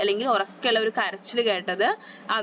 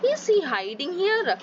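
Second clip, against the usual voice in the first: sly fox; 7 semitones higher, much slower than usual. A narrator's reading. Fox sniffs at the mushroom.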